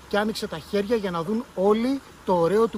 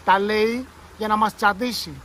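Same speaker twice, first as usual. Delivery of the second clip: angry outburst; 4 semitones higher, 7 dB louder than usual. A narrator's reading. Petya keeps shouting angrily.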